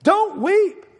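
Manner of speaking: high-pitched, excited exhortation; exultant urging. Acted. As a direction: urge them joyfully, pitch leaping up. Exultant announcement of the Lion.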